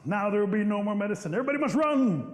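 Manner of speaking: building to a high, loud cry; rising alarm. Acted. Chief ordering everyone to flee, alarmed. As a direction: escalate into a panicked holler.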